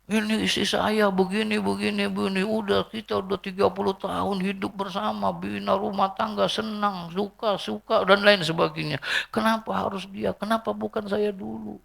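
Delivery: high, soft whining plea held on one note; mock widower's lament. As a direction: imitate a husband wailing over his dead wife. Acted widower lamenting thirty years together. (ratapan duda yang dilebih-lebihkan)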